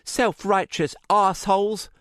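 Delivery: loud, furious high cry; storming-in fury; angry outburst. A narrator's reading. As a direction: spit out in a rage. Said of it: "Shireen stormed through".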